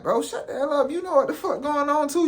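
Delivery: retold heated argument, voice high; heated telling off. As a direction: snap back at the son. Arguing with the son.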